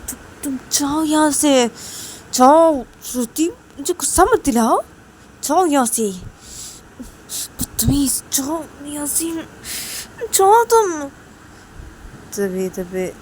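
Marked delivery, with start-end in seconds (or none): 0.1-11.0 higher, louder, yelling her out of the room; furious shout. Acted. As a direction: lose your temper, shout her away. Siya furious at Shruti.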